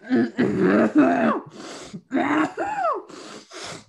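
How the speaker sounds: harsh throat cough; coughing fit. Real, not acted. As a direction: cough hard to clear throat. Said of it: "Is your voice all right?".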